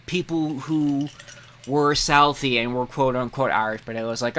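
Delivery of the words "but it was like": ordinary talk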